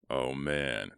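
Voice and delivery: plain reading voice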